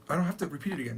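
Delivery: ordinary talk, nothing marked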